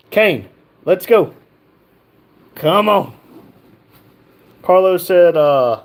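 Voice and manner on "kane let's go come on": loud, higher call to a lazy dog. Real, not acted. Urging his lazy Great Dane to come, loud call. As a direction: holler at the dog to get moving.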